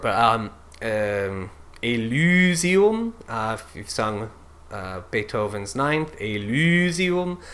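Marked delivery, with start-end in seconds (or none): 1.8-3.1 "Elysium" sung with a pure Ü; German diction; Ü vowel. Shown as a demonstration. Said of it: "this first syllable is Ü". 6.2-7.4 "Elysium" sung with a pure Ü; German diction; Ü vowel. Shown as a demonstration. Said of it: "this first syllable is Ü".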